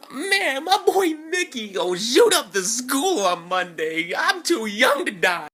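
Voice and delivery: high, loud, hammed-up wail; paranoid panic. Teller dreading school on Monday, panicked and paranoid. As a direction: wail it in comic terror. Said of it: "I'm fucking paranoid that whole weekend".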